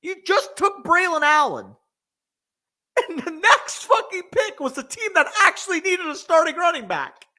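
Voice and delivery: fuming over the pick, pitch way up; indignant rant. Real, not acted. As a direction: spit out the complaint in disbelief. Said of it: "I had to cuss that out".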